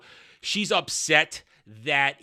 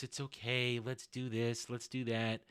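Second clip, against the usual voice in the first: put-on much softer, coaxing kid along; hushed and sweet, faking kindness; soft mock niceness; 4 semitones lower, 12 dB quieter than usual. Princess coaxing the kid, soft put-on niceness. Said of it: "she's trying to be nice".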